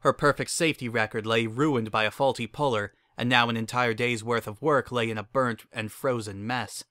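ordinary delivery, nothing marked

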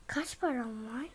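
quiet unvoiced whisper. Real, not acted. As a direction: whisper it to yourself. Whispered question. (fısıltı)

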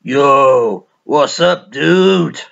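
loud hailing dude voice; hammed-up excited dude shouting over; guitar-shop dude. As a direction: call out a big loud greeting. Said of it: "and you'll go".

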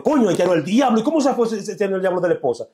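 a high, loud, astonished cop; amazed policeman. Retold or imitated. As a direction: squeal it like a stunned policeman. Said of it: "que el policía dijo". (policía asombrado, voz aguda)

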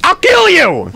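high strained yell of a threat; furious shout. Acted. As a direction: explode in a sudden, high-pitched roar. Host's ranting outburst at cohost.

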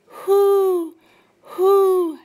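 high loud hooting owl call. A narrator's reading. Owl calling to Brooke, loud high hoots. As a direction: hoot like an owl.

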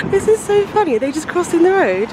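high-pitched, giggly amazement; amused laughing. Real, not acted. Vlogger spotting geese crossing the road, laughing.

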